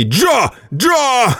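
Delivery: desperate high yelling; yelling himself hoarse. Acted. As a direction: bawl the name over and over. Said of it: "надрывался Герат".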